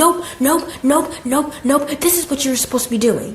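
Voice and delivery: scolding boss. Retold or imitated. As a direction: cut them off, imitating a cross boss. Acting out boss cutting her off.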